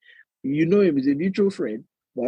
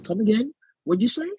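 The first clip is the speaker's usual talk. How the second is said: mock Oyibo query; 9 semitones higher than usual. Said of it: "They'll say something like".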